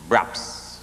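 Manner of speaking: a quick voiceless thud noise; braps sound. Retold or imitated. Storyteller voices the slaves being dropped in the West Indies. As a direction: drop it in like a sudden slam.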